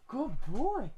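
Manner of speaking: cooing praise at the dog for a trick. Real, not acted. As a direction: coo it to a good dog.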